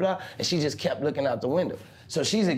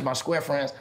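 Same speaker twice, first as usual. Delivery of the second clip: ordinary delivery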